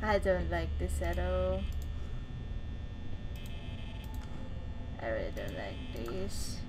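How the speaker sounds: low, soft and jittery, scared to speak up; tense hushed murmur alone in the dark; nervous hushed voice. Real, not acted. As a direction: hold your breath and murmur through the fear. Scared alone at night in game.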